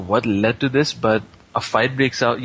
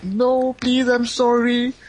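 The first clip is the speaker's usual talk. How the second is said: high, drawn-out begging wail; mock pleading; 13 semitones higher, much slower than usual. Boy begs girl before killing blow, mock pleading. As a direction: beg for mercy in hammed-up panic.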